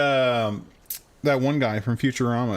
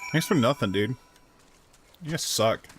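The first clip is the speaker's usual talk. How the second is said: ordinary talk, nothing marked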